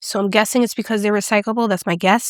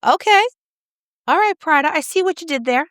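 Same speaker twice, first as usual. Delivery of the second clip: excited; 7 semitones higher than usual. Real, not acted. Delighted reaction to blush.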